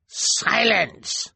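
sharp, high command. A narrator's reading. Elder silencing the prisoner, sharp command. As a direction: shut him up.

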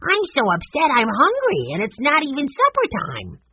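put-on high, upset little-boy voice; complaining young boy. A narrator's reading. Benny is hungry before supper time, high complaining boy voice.

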